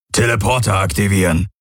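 lightly voiced alien command; calling out. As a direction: call it out to the hall. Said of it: "rief der Aur".

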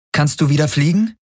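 high-pitched urgent question; anxious heroine. A narrator's reading. Kirai radios her damaged ship, urgently questioning.

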